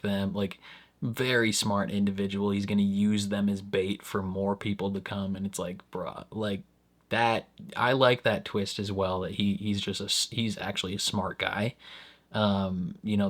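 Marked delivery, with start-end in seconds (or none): none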